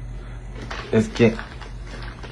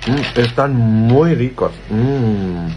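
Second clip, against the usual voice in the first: saboreando; much slower than usual. Real, not acted. Comiendo chocolate.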